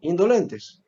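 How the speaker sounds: ordinary talk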